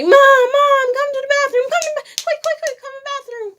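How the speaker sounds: high loud frantic calling, near crying; panicked screaming. Retold or imitated. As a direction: scream for mom from the bathroom, desperate. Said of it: "I'm screaming".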